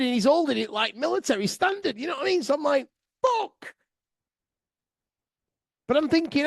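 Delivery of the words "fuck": scared swear. Retold or imitated. Sees man with AK-47.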